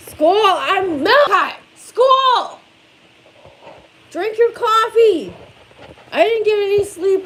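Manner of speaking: loud, high yelling. Real, not acted. Mom yelling at her son to get to school. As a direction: shout him awake, exasperated.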